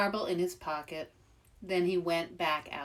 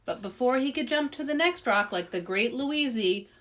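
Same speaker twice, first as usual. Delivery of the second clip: ordinary delivery, nothing marked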